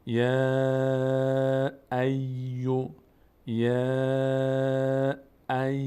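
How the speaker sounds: یا ای spelled out very slowly, each sound held; syllable by syllable. Shown as a demonstration. Teacher spelling the verse slowly for students. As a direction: stretch یا ای syllable by syllable.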